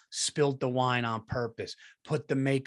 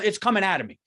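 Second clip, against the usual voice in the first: ordinary talk, nothing marked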